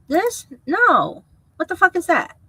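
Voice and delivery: high, loud, incredulous "what the fuck"; exasperated outburst. Real, not acted. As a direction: scoff at the layout in disbelief. Mocking a creator's tiny window.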